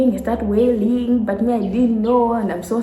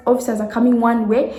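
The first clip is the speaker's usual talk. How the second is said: usual speaking voice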